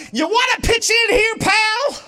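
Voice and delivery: high, loud angry shout at him. Retold or imitated. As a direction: bark it like a fed-up dad. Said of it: "I just shout".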